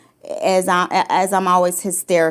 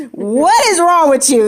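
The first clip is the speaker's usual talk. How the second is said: higher, louder demand; outraged yell; 7 semitones higher, 5 dB louder than usual. Retold or imitated. To the husband slumped in the chair, outraged yell.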